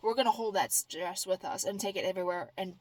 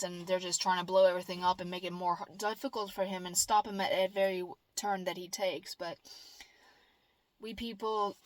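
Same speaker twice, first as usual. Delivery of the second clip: ordinary talk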